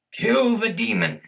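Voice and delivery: commanding battle cry, high and loud; furious shout. Acted. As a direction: roar the order to kill. Prophet commands attack.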